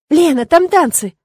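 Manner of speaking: loud, high, drawn-out excitement; excited call. A narrator's reading. Irma calling Lena to the dancing, loudly excited. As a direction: call your friend over eagerly. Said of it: "обернулась ко мне Ирма".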